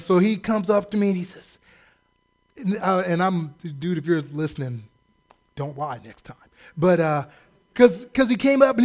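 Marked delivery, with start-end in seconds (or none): none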